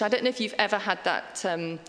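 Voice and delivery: normal talking voice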